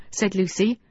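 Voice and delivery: usual speaking voice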